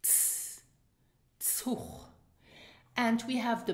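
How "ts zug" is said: Ts then Zug whispered, ts at the start; whispered ts sound in Zug; whispered model sounds. Shown as a demonstration. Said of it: "has in Luxembourgish the ts sound".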